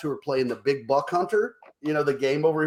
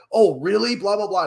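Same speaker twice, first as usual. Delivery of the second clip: high, loudish mock tirade; exploding in outrage; 6 semitones higher, 4 dB louder than usual. Girl keeps exploding at boss, mock sarcastic outburst. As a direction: imitate an angry rant, pitched up. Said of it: "it's, like, exploding".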